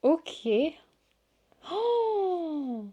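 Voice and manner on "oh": high, airy "oh" of wonder; awed gasp. Real, not acted. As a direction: gasp at a beautiful sight. Émerveillée en découvrant les cartes. (oh émerveillé, aigu)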